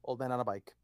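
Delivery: low, soft, half-whispered aside; hushed echo. Real, not acted. Reader repeats a listener's nickname, hushed aside. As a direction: drop it down to a quiet murmur.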